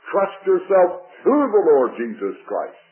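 ordinary delivery

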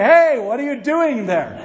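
loud, startled "hey, what are you doing"; indignant challenge. Retold or imitated. Truck owner to stranger taking his pickup, indignant loud call.

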